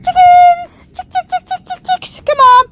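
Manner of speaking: high sing-song chicken call. Real, not acted. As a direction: call the hens in loudly. Calling chickens to follow.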